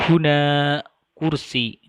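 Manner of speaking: "huna kursi" slow and loud, weight on "hu" and "kur"; no stress on final vowel. Shown as a demonstration. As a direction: stress the first syllable of each word in "huna kursi". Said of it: "pada akhir kata tidak pernah diberi". (tekanan pada "hu" dan "kur" dalam "huna kursi")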